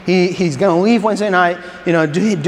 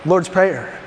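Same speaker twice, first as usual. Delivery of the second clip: ordinary delivery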